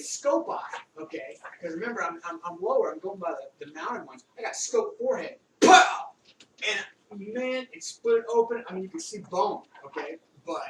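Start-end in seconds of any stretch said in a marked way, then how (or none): 5.6-6.1 sudden high, loud "pow"; shouted impact noise. Retold or imitated. Storyteller mimics the scope hitting his forehead, explosive shout. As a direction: burst out with the bang of the hit.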